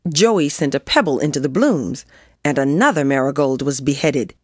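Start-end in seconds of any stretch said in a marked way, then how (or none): none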